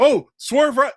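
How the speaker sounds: loud, high parody cry; mock alarm. Comedy sketch spoofing Star Trek, loud mock alarm. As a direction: call it out high like a spoofed crew.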